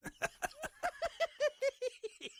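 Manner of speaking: high, soft, distant laugh from the shadows; shady cackle. Acted. Shady townsfolk snickering at the travelers. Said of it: "shadier side of its inhabitants".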